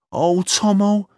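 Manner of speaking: high, relieved cry; tearful relief. A narrator's reading. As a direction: cry out his name in relief. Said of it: "she cried, hugging him with relief".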